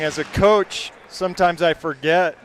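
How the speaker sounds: normal talking voice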